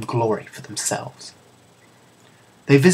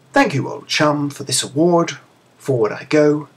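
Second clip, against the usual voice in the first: put-on a slightly higher, lightly posh British accent on "Thank you, old chum"; 4 semitones higher, 4 dB louder than usual. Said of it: "in his best British accent".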